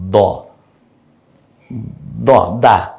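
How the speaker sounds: দ said light and thin. Shown as a demonstration. Teacher showing light দ to students. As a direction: keep the mouth thin on দা. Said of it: "মুখটা একটু পাতলা করে বলেন".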